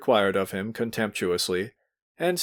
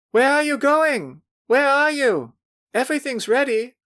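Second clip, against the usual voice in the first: put-on high, louder girlish calling; ringing impatient girls; 5 dB louder than usual. A narrator's reading. Girls calling the men back to the party, high and ringing. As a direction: call out like impatient young girls. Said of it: "ringing, impatient voices of the girls".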